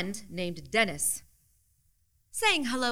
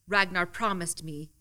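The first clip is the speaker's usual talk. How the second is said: plain reading voice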